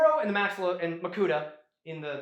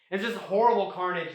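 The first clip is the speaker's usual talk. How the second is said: usual speaking voice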